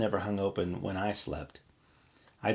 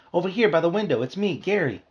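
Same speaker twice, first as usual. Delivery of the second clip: high, louder eager call; 8 semitones higher, 4 dB louder than usual. Acted. Bee begging his sister to notice him, eager. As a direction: wave her over with your voice.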